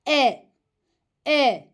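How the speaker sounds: "E." recited as a short, clear, thin e vowel; reciting letter sound. Shown as a demonstration. Teacher sounding a thin letter for children, clear e. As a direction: call out "E." crisply like a Quran teacher. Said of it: "İnce bir harf".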